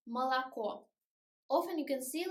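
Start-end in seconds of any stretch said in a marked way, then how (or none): 0.1-0.8 "молоко" with two short schwas and stressed final O; reduced unstressed O. Shown as a demonstration. Teacher showing the right pronunciation of "молоко", unstressed vowels reduced. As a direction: say "молоко" as muh-la-KO, mouth barely open. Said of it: "we should pronounce it like".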